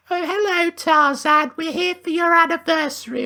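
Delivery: high-pitched mock visitors' chorus; gushing visiting friends. Friends turning up for an anniversary, gushing high mock voice. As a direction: greet them in a silly high put-on voice. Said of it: "her friends had come over".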